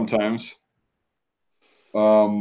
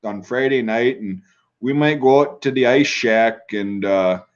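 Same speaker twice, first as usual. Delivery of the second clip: put-on Minnesota accent. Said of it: "Minnesotans really enunciate the out".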